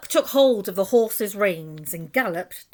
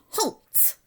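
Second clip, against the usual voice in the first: a high, sudden barked halt; deafening bellow; 11 semitones higher than usual. A narrator's reading. Rat stops the ants, deafening bellowed halt. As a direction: stop them dead with one cry. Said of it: "bellowed a deafening".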